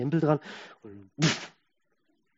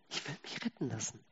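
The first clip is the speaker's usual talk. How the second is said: quiet echo; 3 semitones lower, 15 dB quieter than usual. Shown as a demonstration. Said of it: "repeated line".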